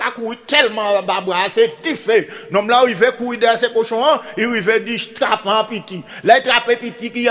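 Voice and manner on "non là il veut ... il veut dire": plain reading voice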